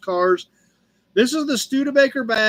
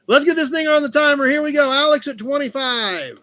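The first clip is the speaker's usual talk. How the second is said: hyped auction call; 6 semitones higher, 3 dB louder than usual. Real, not acted. Auction countdown hype call.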